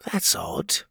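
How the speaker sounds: an inner-thought whisper; thought aloud. Acted. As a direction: whisper it to yourself. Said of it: "he thought".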